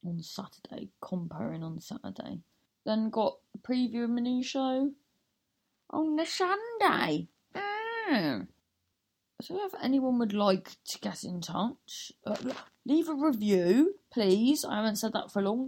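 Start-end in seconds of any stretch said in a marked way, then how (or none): 0.1-0.9 quiet unvoiced whisper on on Saturday; whispered aside. Real, not acted. Whispering the Saturday date to listeners. 12.9-14.6 sing-song plea. Real, not acted. Host pleading sweetly with listeners for reviews.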